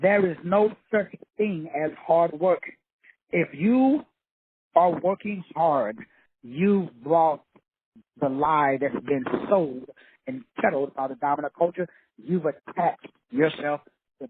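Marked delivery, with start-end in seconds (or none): 0.0-8.3 slow, deliberate, every word spaced out; slow it down so it sinks in; x0.7 pace, letting each word land. Shown as a demonstration. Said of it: "Let me say that again slow".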